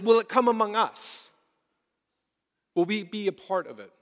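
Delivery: ordinary delivery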